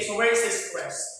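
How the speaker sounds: ordinary talk, nothing marked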